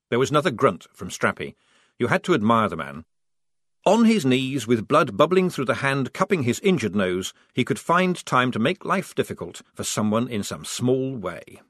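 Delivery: ordinary delivery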